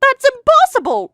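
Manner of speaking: high, loud disbelieving cry; shocked outburst. Acted. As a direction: blurt it out in shock. Shocked outburst at the wedding budget.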